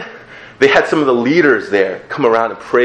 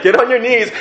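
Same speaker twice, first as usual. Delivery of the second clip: mock leader order; 12 semitones higher than usual. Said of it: "And they're like".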